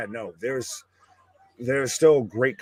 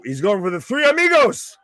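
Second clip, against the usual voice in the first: higher, louder excited call; excited live reaction; 9 semitones higher, 7 dB louder than usual. Real, not acted. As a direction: call the move out with rising excitement. Reacting live to a wrestling move.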